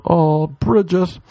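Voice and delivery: slow drawn-out moaned name; mock moan. Shouting during sex, slow mock moan.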